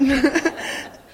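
bright burst of laughter; laughing. Real, not acted. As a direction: laugh at yourself. Laughing at own hoarse voice.

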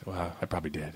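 very soft, low mumbled quote; sheepish admission. Retold or imitated. Director concedes to his dance partner, hushed and sheepish. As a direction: imitate a grudging, hushed concession.

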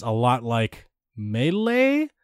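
MELEE drawn out with a rising lilt; tentative upward inflection on MELEE. Real, not acted. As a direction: lift your voice on MELEE, as if hedging. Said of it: "I said it with an inflection".

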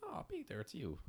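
high-pitched mock accent for a dog; put-on dog voice. Listener voicing Peter's dog recognising him, put-on accent. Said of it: "why did I give her that accent?".

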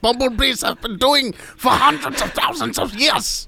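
put-on high sing-song German accent. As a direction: ham up a German scientist dad. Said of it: "this might be your best accent yet".

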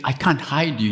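ordinary delivery

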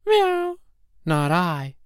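high meowing cat refusing; cat's high meow and lazy no. Acted. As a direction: meow it, then refuse lazily. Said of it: "the cat said".

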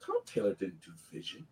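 very soft, low aside to oneself; retold doubt, dropped almost to nothing; quiet puzzled thought. Fan at the panel doubting to himself, quiet.

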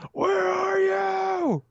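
long, high, drawn-out mock yell; imitated shout. Imitating Spider-Man calling through the fire, mock yell. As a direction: stretch the call out like a hammed-up movie hero. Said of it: "he's running around going".

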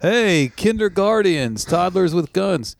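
high, drawn-out mock greeting; mock cheery presenter. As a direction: stretch it out high like a hammy kids' promo. Mimicking the montage of guests.

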